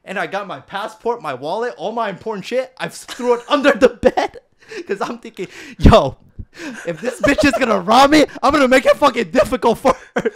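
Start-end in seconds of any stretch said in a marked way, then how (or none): 5.8-10.3 higher, louder, laughing through it; laughing at own paranoia. Retold or imitated. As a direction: chuckle while voicing the scheming thought. Retold paranoid thought, laughing.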